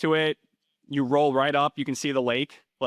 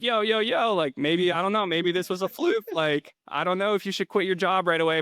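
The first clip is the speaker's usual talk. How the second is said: alarmed caution; 7 semitones higher than usual. Retold or imitated. Urging mentee not to quit.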